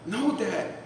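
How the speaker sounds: put-on high, eager boy's voice; excited son. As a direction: insist with a kid's excitement. Son insisting on the phone.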